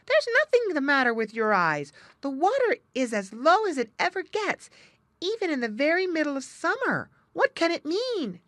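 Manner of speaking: high, loud otter voice; astonished cry. A narrator's reading. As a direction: exclaim it high and loud, all worked up. Otter astonished at the low water.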